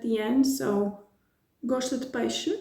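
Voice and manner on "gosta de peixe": "Gosta de peixe?" as a statement with a rising question tune; asking "Gosta de peixe?" with a rising end; question intonation. Shown as a demonstration. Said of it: "with an intonation of a question".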